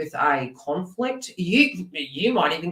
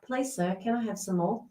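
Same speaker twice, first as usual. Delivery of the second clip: lightly voiced timid plea, a bit softer; meek pleading; 2 semitones higher, 6 dB quieter than usual. Reader imitating the ex begging the Queen of Swords. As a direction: ask like a humble orphan. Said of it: "would you not be like".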